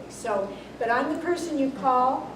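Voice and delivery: normal talking voice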